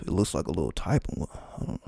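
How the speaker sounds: ordinary talk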